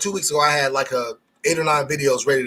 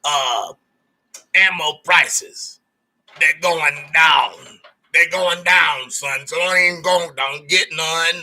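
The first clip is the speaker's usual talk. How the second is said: crackhead Joe Morgan. Retold or imitated. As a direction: lean in and let him in on a secret, hustler style. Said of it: "this is what he said".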